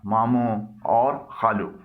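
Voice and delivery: ordinary delivery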